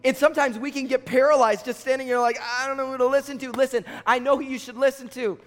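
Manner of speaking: high, driving sermon delivery; impassioned preaching. Real, not acted. Preacher urging students to listen to God's voice, impassioned preaching. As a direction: preach it with urgency, pitch held high.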